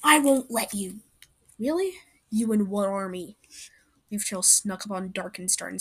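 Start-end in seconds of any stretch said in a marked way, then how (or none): 0.0-1.0 defiant. Acted. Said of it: "Split Claw said". 1.6-3.4 mocking. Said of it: "villain mocking the warrior".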